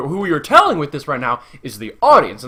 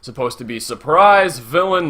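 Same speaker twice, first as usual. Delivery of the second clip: ordinary talk, nothing marked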